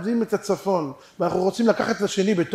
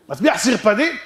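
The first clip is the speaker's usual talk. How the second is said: higher query rising on "סרפדים"; raised-voice question ending on "סרפדים"; insistent question; 5 semitones higher, 4 dB louder than usual. Acted. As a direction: ask it keenly, lifting "סרפדים". Mounting question.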